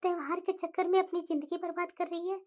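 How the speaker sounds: low, calm mother voice; mother calming her angry daughter, low and gentle; soothing mother. Acted. Mother lamenting.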